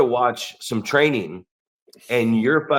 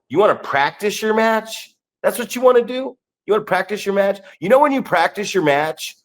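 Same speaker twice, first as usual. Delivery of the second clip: incredulous mockery; 6 semitones higher than usual. Mocking incredulous question.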